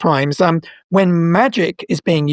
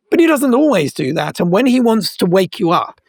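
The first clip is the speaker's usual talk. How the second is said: normal talking voice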